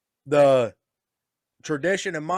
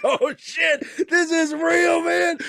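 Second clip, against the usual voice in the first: much higher, louder, slow panicked squeal; panicked kid; 13 semitones higher, 5 dB louder, much slower than usual. Retold or imitated. Son realizes his dad means it, high panicked squeal. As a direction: squeak it out like a scared kid realizing it's real. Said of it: "I was just like".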